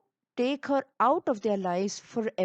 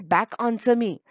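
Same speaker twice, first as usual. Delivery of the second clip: ordinary delivery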